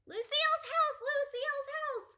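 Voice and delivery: high, loud, thrilled yell; excited shout. Acted. Child arriving at Lucille's house, shouting with joy. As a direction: shout it out the car window. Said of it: "I shouted out".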